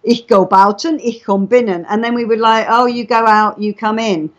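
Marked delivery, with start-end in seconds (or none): none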